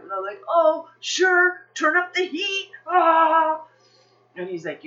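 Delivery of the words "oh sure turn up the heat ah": high, loud sarcastic dismay; hammed-up freak-out ending in a wail; mock panic. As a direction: cry out in exaggerated dread at the challenge. Panicking at husband's challenge.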